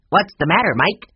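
loud, high squawking parrot; talking bird. A narrator's reading. Mina the bird repeating the words back, loud squawk. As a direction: rattle it off like a mynah bird. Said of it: "went right along without stopping".

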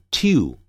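tiu with a y glide before the oo; British "to" said as "tyoo"; British pronunciation. Shown as a demonstration. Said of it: "在英式英语中". (英式发音，to念成带一音的tiu)

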